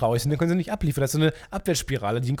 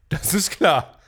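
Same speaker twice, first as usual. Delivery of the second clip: put-on voice; 4 semitones higher, much slower than usual. Shown as a demonstration. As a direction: bark "Das ist klar" in a put-on voice. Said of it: "repeated with contrast".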